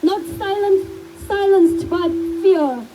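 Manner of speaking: measured, emphatic public address with long pauses; solemn tribute speech. Real, not acted. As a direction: deliver a heartfelt eulogy as a rallying speech. Delivers a written tribute speech.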